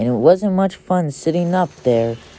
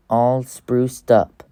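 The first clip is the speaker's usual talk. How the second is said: usual speaking voice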